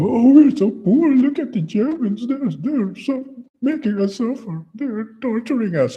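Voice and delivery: very high, hammed-up falsetto pleading; mock victim wail. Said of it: "They went from".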